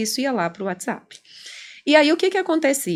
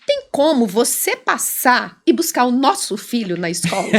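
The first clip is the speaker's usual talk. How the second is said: angry reading. Shown as a demonstration. Client reading his wife's text as hostile, angry. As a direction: read it as an accusing, mock-angry order. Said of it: "Ele lia assim".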